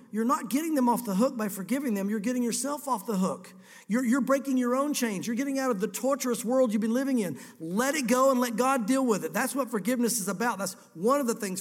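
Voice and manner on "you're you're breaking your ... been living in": ordinary delivery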